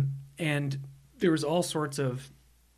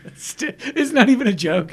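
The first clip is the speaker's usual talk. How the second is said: high, loud, laughing out the words; 8 semitones higher, 8 dB louder than usual. Real, not acted. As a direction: crack up while talking. Laughing through the line.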